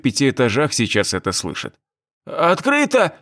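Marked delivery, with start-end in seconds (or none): 2.2-3.2 high cracking frightened cry; voice cracking shout. A narrator's reading. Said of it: "сорвавшимся голосом крикнул Федюнин".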